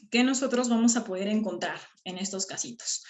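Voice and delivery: ordinary talk, nothing marked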